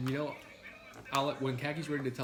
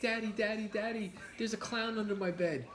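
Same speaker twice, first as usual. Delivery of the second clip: put-on child voice; 7 semitones higher than usual. Acting child voice in story.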